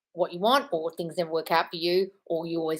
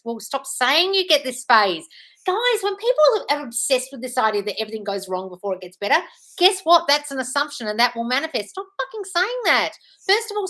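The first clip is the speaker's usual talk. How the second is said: raised, higher, emphatic telling-off; exasperated scolding; 5 semitones higher, 5 dB louder than usual. Real, not acted. Coach telling viewers to drop a belief, exasperated. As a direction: lay down the law, exasperated.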